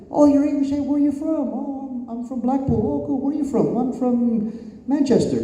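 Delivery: high-pitched, put-on English chat; mock English accent. English wrestlers meeting a countryman in a bar, mock English accent. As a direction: imitate chummy English strangers, accent hammed up. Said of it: "some of the English wrestlers that I know".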